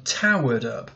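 "towered" hit hard on its first syllable; stress on TOWERED. Shown as a demonstration. Teacher marking the stress for the class, stress on TOWERED. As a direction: lean on the TOW of "towered". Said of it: "we emphasize the first syllable there".

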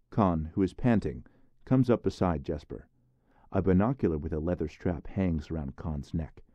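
plain reading voice

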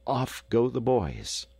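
ordinary delivery, nothing marked

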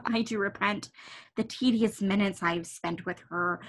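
ordinary talk, nothing marked

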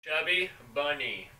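slow, muffled by marshmallows; mouth full. Real, not acted. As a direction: say it carefully around a mouthful. Next round, marshmallows in mouth.

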